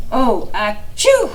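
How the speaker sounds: high loud sneeze. Acted. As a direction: sneeze on chew. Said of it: "said the spider, wheezing".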